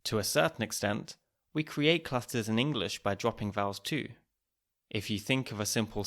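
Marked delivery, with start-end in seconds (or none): none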